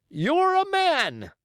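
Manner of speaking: high, slow, drawn-out admiring cry. A narrator's reading. Coxswain hails Silver, high drawn-out admiring cry. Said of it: "cries the coxswain".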